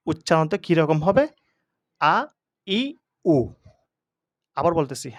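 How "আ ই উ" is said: "আ, ই, উ" each cut short; teacher demonstrating short "আ, ই, উ"; quick short vowels. Shown as a demonstration. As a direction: say "আ, ই, উ" as brief separate vowels, never drawn out. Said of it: "হরকতের উচ্চারণ তো হবে তাড়াতাড়ি".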